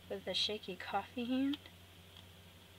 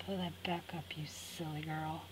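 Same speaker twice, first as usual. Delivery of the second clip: whispering; 4 semitones lower, 5 dB quieter than usual. Real, not acted. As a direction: hush it to yourself, teasing. Scolding herself while working.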